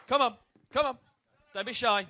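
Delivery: usual speaking voice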